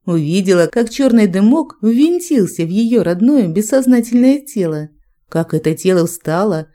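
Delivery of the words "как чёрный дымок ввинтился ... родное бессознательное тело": plain reading voice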